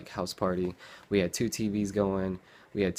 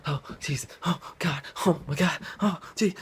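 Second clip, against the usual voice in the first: rising panic; 8 semitones higher than usual. Retold or imitated. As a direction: build from groan to near shout. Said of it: "panicked driver".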